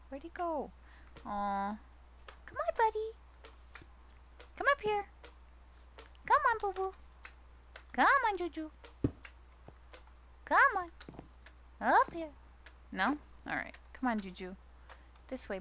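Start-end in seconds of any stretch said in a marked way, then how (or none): 2.4-12.3 much higher coaxing call to a pet; sing-song coax the dog up. Real, not acted. Calling the pet up.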